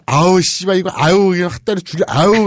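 loud furious father threatening; angry growling. Acted. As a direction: shout it while raising a club. 몽둥이 든 아버지. (몽둥이 든 아버지의 화난 고함)

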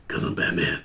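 low whispered Batman line. Retold or imitated. Imitating Michael Keaton's Batman for podcast friends, low whisper. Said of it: "and he goes".

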